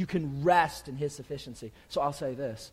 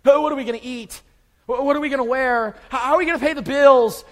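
much higher and louder, anxious fretting; mock panic; 8 semitones higher, 12 dB louder, faster than usual. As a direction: play the worried unbeliever panicking over money. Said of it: "that's what Gentiles stress out about".

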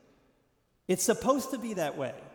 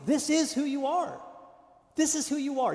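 emphatic declaration; 9 semitones higher than usual. Real, not acted.

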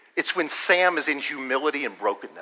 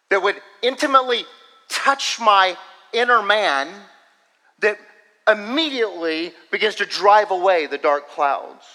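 higher, impassioned plea to the Lord; fervent prayer; 6 semitones higher, 4 dB louder than usual. Shown as a demonstration. Preacher showing how to ask Jesus for a fresh word, fervent prayer. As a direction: pray it with building intensity, stretching key words.

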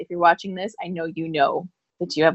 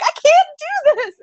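much higher, panicked wail; retold panic; 5 dB louder than usual. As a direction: cry out in mock despair that you can't manage it. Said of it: "'cause I was panicking".

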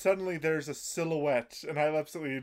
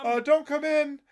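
high, loud panicked call; girl yelling out; 12 semitones higher, 8 dB louder than usual. Retold or imitated. Girls calling through the door to the teacher, high panicked. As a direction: call out like a flustered schoolgirl. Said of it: "girls hiding from teacher".

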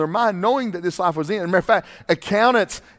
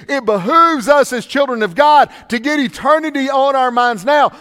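raised, louder preaching voice; 7 semitones higher, 7 dB louder than usual. Acted. Preacher urging the church to fix eyes on eternity, preaching hard. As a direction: press the point home to the pews.

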